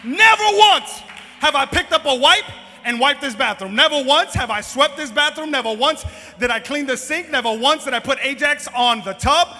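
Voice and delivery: loud, emphatic outburst of disbelief; full-force comic confession from the pulpit; impassioned preaching. Real, not acted. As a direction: hammer each phrase out like a shouting preacher.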